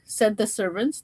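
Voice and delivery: ordinary delivery, nothing marked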